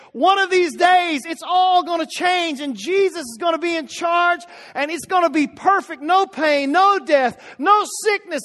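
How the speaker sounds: preaching at full force. Acted. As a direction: build to a triumphant shout.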